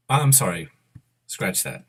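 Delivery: ordinary talk, nothing marked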